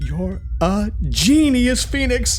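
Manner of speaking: high, slow delighted cry; thrilled with the idea; excited praise. Acted. Praise after a clever idea.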